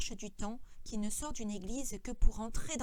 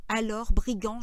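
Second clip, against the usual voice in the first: ordinary delivery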